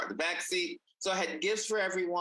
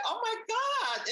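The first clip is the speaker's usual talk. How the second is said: much higher, slow, emotion taking over; retelling breaking down in the car, much higher and slow; overwhelmed. Retold or imitated. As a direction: let it catch you as the song hits. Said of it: "I lost it in my car".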